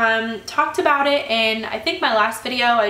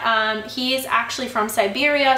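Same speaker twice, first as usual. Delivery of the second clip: ordinary delivery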